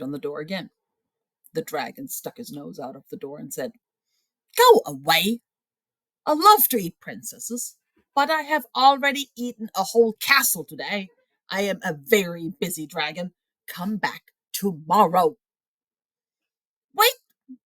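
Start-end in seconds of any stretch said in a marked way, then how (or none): 4.6-15.4 a dragon shooing a princess from his door; grumpy dragon. Acted. 16.9-17.6 sharp, high, loud call; shouted. A narrator's reading. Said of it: "shouted Elizabeth".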